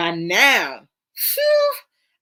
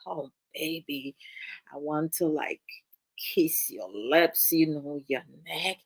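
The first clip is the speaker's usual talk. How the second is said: low, soft seductive murmur; naughty sweet talker; 3 semitones lower, 5 dB quieter than usual. Pretend. Charming man talking naughty to his woman, low and seductive. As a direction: croon it like a charming flirt. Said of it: "if we have a man that's, like, naughty".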